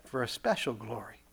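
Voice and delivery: soft, measured divine declaration; voice of God. Acted. God declaring man's special glory, hushed and solemn. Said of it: "And God says".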